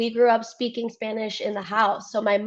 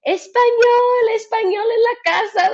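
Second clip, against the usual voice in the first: imitating parent; 12 semitones higher, 6 dB louder than usual. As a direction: call it from the next room like a strict mom. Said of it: "you would always hear it from the living room".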